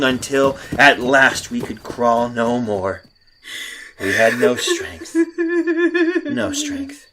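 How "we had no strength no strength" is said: broken, half laughing half sobbing, pitch climbing to a high wail; hysterical laugh-cry. Acted. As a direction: crack up into laughing tears at the end of your strength. Exhausted fugitive breaks down.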